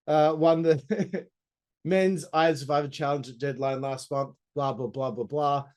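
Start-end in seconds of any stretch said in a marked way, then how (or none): none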